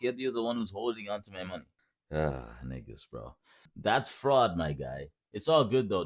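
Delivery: ordinary talk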